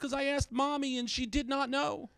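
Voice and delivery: put-on high, slow kid voice; innocent son. Son tells dad why he's asking, innocent kid voice. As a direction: explain it like a guileless child. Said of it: "He goes".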